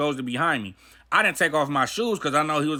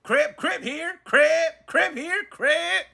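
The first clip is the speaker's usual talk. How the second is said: calling out; 10 semitones higher, much slower than usual. Retold or imitated. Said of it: "my celly walk out like".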